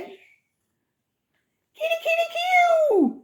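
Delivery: very high, sing-out cat call; kitty call. Real, not acted. Calling a stray cat.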